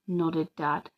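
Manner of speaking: ordinary delivery, nothing marked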